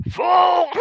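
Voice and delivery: mock high squeal, shouted; voice up octaves. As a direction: squeal the count like someone in pain. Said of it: "his voice goes up an, an octave or two".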